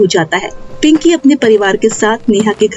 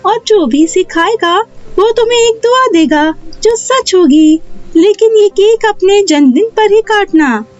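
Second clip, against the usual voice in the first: high-pitched magical fairy voice; kindly fairy queen; 9 semitones higher than usual. Acted. Fairy hands Neha the magic cake with rules, kindly high voice. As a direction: give instructions as a fairy, pitched well up.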